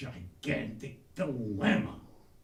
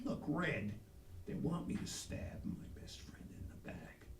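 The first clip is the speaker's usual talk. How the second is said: hushed confession; 12 dB quieter than usual. Retold or imitated. Pep confides in his dad.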